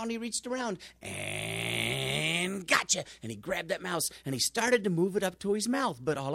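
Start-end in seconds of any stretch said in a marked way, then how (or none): 1.0-3.0 slow drawn-out gotcha. Acted. Cat pouncing on mouse.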